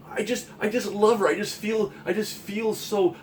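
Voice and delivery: mock dreamy infatuation; lovesick friend. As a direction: sigh it out like a helpless lover.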